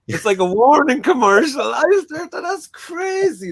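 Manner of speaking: Robin Ince warning ad. Retold or imitated. Guest mocking a podcast guest's delivery as a warning ad. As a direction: ham it up like a TV warning commercial. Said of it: "the way he said it".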